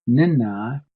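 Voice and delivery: "Nenai" said high and loud, rising at the end; emphatic pronunciation. Shown as a demonstration. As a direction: model "Nenai" with strong emphasis. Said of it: "Emphasize on that".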